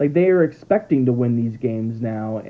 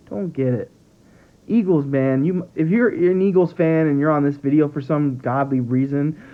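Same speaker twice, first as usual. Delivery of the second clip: ordinary talk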